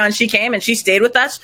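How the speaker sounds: normal talking voice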